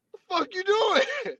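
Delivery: high, mock-startled woman's voice; imitating Pam Grier. Retelling the film to a friend, high mock-startled woman.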